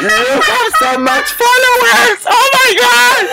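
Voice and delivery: slow, high-pitched mock squeal of awe; imitating starstruck Japanese man. Japanese man amazed at the follower count, imitated high squeal. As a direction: stretch it out in a shrieking fan voice. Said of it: "el loco me sigue y dice".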